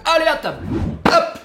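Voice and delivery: high, loud call to come eat; calling to the table. Real, not acted. Host summoning viewers to eat, loud call. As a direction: sing it out to call everyone in.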